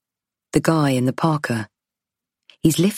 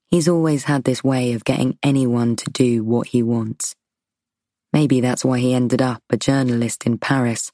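usual speaking voice

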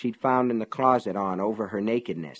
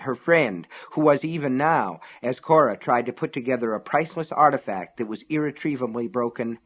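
ordinary delivery, nothing marked

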